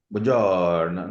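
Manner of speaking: mock Italian, long drawn-out vowel; hammed-up Italian lilt, slow; exaggerated Italian accent. Shown as a demonstration. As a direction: stretch buongiorno in a sing-song Italian way. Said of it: "I don't speak like an accent".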